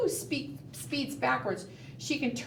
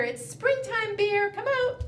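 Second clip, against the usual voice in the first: calling the bear; 9 semitones higher than usual. Pretend. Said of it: "we're gonna have to say to that bear".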